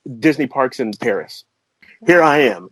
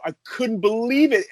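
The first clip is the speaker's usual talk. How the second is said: ordinary talk, nothing marked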